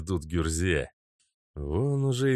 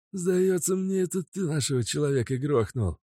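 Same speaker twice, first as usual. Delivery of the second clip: muttering thug; 8 semitones higher than usual. Acted. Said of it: "пробубнил Мазут".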